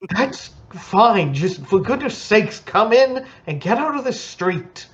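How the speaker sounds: high, fussy little servant voice; fussy halfling butler. Acted. Halfling butler hurrying guests inside, high and fussy.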